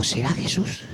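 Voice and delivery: low, hushed doubt to himself; hesitant wondering. Retold or imitated. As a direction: wonder it under your breath, unsure. He doubts whose voice it is.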